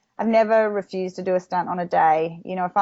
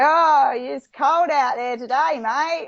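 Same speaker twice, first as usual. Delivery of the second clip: put-on slow, higher thick Australian accent; 6 semitones higher, slower than usual. Actress imitates her co-star's thick Australian accent. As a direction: mimic his thick Australian. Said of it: "thick Australian accent".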